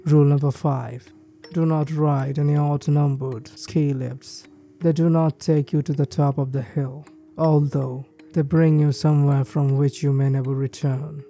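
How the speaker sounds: ordinary delivery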